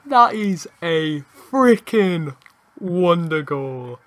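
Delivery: slow, drawn-out emphatic celebration; awed excitement. Real, not acted. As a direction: savour every word of the goal. Celebrates the wonder goal.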